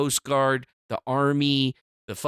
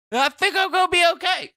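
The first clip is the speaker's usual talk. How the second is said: high reassurance; 14 semitones higher, 4 dB louder than usual. Pretend. High self-reassurance, then repeated.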